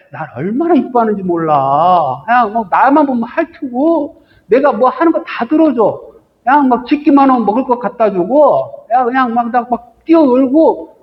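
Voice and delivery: a spoiled pet showing off to a friend; lightly voiced happy dog boasting; bragging dog. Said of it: "막 이러는 거예요".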